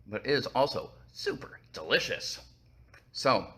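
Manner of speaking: ordinary delivery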